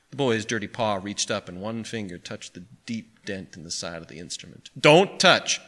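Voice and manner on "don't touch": sharp, louder scolding at higher pitch; cross. A narrator's reading. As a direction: warn the child off crossly. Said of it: "said the pawnbroker crossly".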